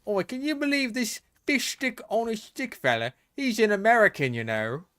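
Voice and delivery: high, hammed-up British accent mocking an American. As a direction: sneer like a stage Englishman, high.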